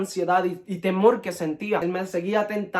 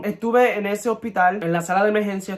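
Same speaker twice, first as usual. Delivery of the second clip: ordinary delivery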